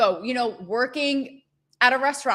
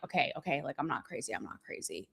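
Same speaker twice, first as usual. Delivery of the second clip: much softer, fading into a hush; self-reassuring murmur; 7 semitones lower, 13 dB quieter, faster than usual. Retold or imitated. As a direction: talk yourself down, nearly inaudible. Reassuring herself, inner voice.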